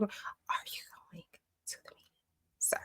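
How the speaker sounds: pointed whispered question; hushed, needling whisper to the student. Acted. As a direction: lean in and whisper it.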